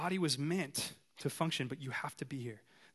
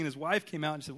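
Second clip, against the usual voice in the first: ordinary delivery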